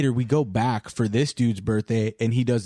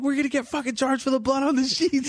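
retold freak-out; 13 semitones higher than usual. Friend recalling his fear of charges, high-pitched freak-out. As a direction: act out panicking over the damage, pitched way up. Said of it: "I freak out".

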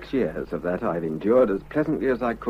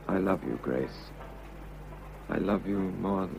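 softer, lower, tender I love you; soft confession; 4 semitones lower, 9 dB quieter than usual. Acted. Husband confessing love to Grace, soft and tender. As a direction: let it sink almost to nothing on her name.

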